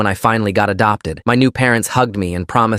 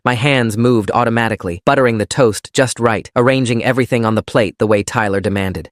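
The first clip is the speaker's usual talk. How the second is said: usual speaking voice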